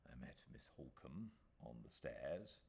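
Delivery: ordinary delivery, nothing marked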